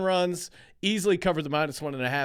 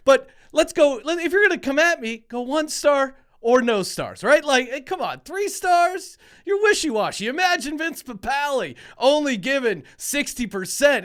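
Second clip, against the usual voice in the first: mock outrage; 7 semitones higher, 5 dB louder than usual. Mock outrage at three-star review.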